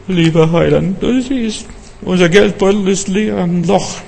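high, plaintive mock prayer; pleading prayer. Believer telling the Saviour about an empty purse, high pleading. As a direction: act out a needy believer praying, pitched well up.

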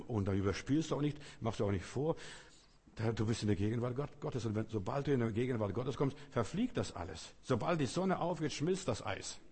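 ordinary talk

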